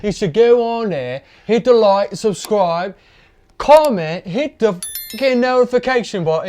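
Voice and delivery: punchy mock British accent; hammed-up British accent; put-on British accent. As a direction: order viewers about in a fake Peaky Blinders British accent. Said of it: "should we be talking like this".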